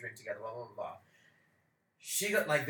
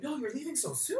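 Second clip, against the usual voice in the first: mock pleading locals; 10 semitones higher, 4 dB quieter than usual. Locals begging them to stay.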